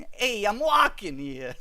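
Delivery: hammed-up Boston accent, drawn out. Shown as a demonstration. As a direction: call it out in mock Boston. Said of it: "Boston ac".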